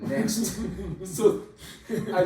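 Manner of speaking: loud, drawn-out words through laughter; real laughing. Real, not acted. Laughs at his own failure.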